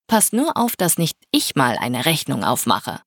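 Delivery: ordinary delivery, nothing marked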